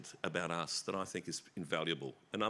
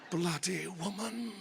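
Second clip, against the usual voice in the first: mock exasperation; 11 semitones higher, slower than usual. Mock exasperation at Judy Davis.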